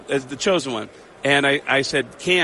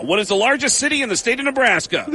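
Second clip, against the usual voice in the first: higher-pitched quizmaster; animated, raised-pitch quiz question; game show host; 7 semitones higher than usual. Retold or imitated. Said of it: "we're gonna do a speed round".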